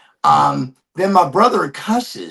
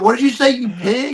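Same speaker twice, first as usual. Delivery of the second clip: brother's challenge; 8 semitones higher than usual. Retold or imitated. Brother repeats challenge to cop.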